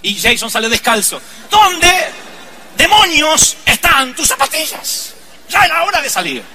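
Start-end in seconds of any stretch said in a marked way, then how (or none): 1.5-6.4 loud, high exasperated dad yell; mock furious father. Father yelling at barefoot son about his shoes, mock exasperation. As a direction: blow up at the kid over the shoes, half in jest.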